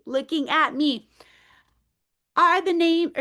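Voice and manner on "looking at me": slow sing-song picture book reading; reading to children. Acted. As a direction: read it aloud to a class of small kids. Reads picture book to kids.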